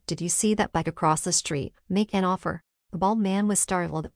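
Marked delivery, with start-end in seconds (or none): none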